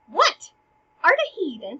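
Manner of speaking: high, loud disbelieving cry; incredulous outburst. Retold or imitated. First clown scoffing at the second clown. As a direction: bark it in mock disbelief. Clown mocking the other's stupidity.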